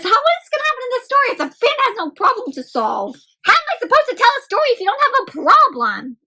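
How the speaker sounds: high, fast frustrated whine; high rushed exasperated whine; exasperated narrator. Acted. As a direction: plead with the panda, fast and high.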